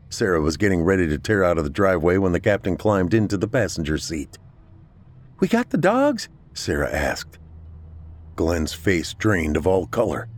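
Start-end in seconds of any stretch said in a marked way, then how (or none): 5.4-6.3 high, anxious asking; urgent question. A narrator's reading. Driver checks on the dogs after the attack, anxious. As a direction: ask it high and worried.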